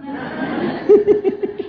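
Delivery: breathy high-pitched chuckle; high laugh. Real, not acted. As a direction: let out a squeaky laugh. (tawa tinggi tertahan)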